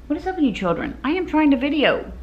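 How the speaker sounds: high-pitched, exasperated telling-off; exasperated scolding. Real, not acted. Vlogger to noisy children while trying to video, exasperated. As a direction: pitch jumps up in real exasperation.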